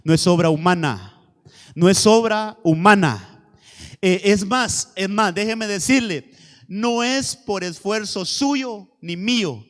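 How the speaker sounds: loud, emphatic declaration to the congregation; full-force preaching. Real, not acted. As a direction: preach it out with slow, weighty stresses.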